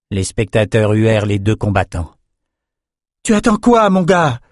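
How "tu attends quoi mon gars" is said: high, loud impatient challenge; called-out goad. A narrator's reading. Lila urging on the hesitating young swordsman, calling out. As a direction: throw the question down at the fighter. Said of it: "lança Lila".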